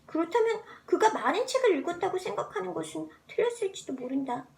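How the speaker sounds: usual speaking voice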